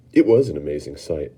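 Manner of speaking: plain reading voice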